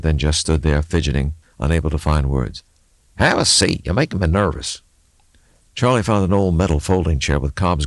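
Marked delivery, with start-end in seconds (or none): 3.1-4.8 put-on higher, musing old mechanic voice; a grizzled local thinking aloud; gruff mechanic. Mechanic Levi talking to the axle, musing aloud.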